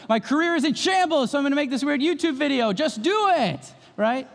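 high, hammed-up self-pep talk; mocking desperate YouTuber. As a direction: mimic a washed-up guy hyping himself.

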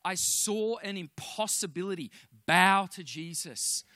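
ordinary delivery

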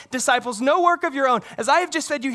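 normal talking voice

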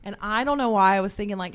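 ordinary delivery

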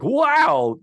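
high, loud, drawn-out wow; mock amazement. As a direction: gush like a starstruck crowd. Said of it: "they're all like".

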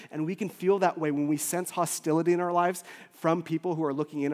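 ordinary delivery

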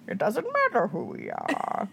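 slow, muffled villain voice; Bane impression. Her weird Bane quote of the day, slow and muffled. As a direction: intone it like a masked villain. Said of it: "my weird Bane quote of the day".

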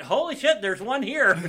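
retelling the rival's surprise at meeting a fan; mock shocked. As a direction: act out his stunned surprise. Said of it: "he went".

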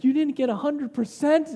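a hint of tiger-parent outrage. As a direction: lightly play a disbelieving tiger parent. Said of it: "the tiger parent in me kinda came out".

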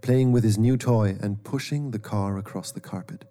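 usual speaking voice

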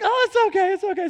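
mom's reassurance. Retold or imitated. Mom forgiving the shell in the dryer, imitated high reassurance. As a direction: pipe up high to brush off the apology. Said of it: "And she's like".

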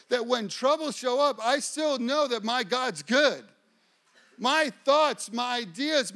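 high, forceful sermon delivery; fervent preaching. Real, not acted. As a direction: drive each phrase home to the congregation.

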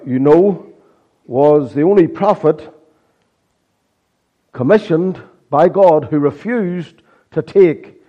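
impassioned sermon delivery; preaching. Acted. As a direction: preach it to the congregation. Preaching with rising force.

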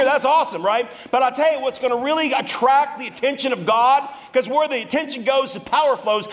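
high, driving preacher's voice; full-force preaching. Real, not acted.

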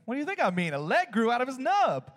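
high, emphatic comeback; imitated buddy's incredulous retort; mock exasperated retort. Friend's incredulous retort, acted out.

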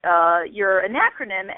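ordinary talk

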